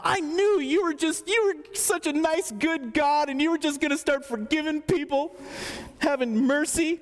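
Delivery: high, whining complaint to God; whiny Jonah. Acted. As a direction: whine it like a pouting prophet. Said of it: "Jonah gets a little whiny".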